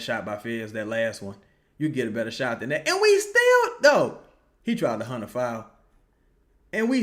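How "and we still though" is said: sudden yell. Real, not acted. Fan yelling at the game.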